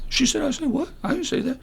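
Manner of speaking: mock bewildered guy. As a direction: play a baffled dude caught in drama.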